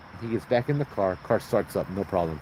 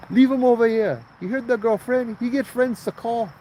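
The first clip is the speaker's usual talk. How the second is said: high, louder bossy auntie; auntie calling out; 11 semitones higher, 3 dB louder than usual. Retold or imitated. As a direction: bark it from the back seat, high. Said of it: "you hear one auntie in the back".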